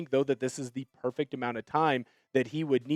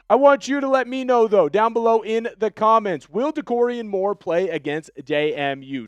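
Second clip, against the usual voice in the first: excited call-out; 6 semitones higher, 4 dB louder than usual. Real, not acted. Calling on viewers to comment.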